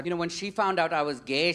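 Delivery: ordinary talk, nothing marked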